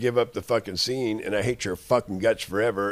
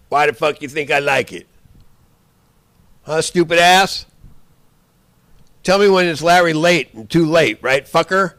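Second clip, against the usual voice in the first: furious yelling; 6 semitones higher, 7 dB louder than usual. Real, not acted. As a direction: pitch up high, loud and raging. Rising furious rant at enemy.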